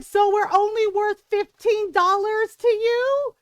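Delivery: loud, high indignant yell; imitating an angry customer yelling. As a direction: yell it slowly, outraged at being shortchanged. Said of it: "Ron yelled on the phone".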